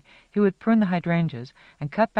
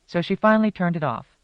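plain reading voice

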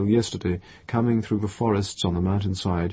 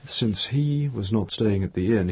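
ordinary delivery

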